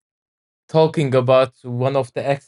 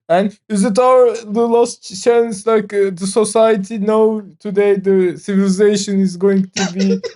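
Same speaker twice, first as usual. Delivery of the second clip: raised, hammed-up doomsayer voice; conspiracy theorist voice; 4 semitones higher than usual. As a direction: play a panicky crackpot predicting doom. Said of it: "I love your crackpot conspiracy theorist voice".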